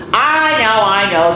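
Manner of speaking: high, loud and fed up, in a hammed-up way; mock exasperated. Book narrator admits to kids the page is upside down, mock exasperated. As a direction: throw up your hands in pretend annoyance. Reading the book's narrator aloud.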